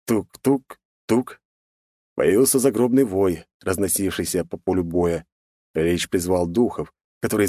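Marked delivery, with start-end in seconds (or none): 0.0-1.4 slow knocking tuk-tuk-tuk; slow tapping sound. A narrator's reading. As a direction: tap it out slowly like a staff. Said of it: "выбивать своим посохом мелодию".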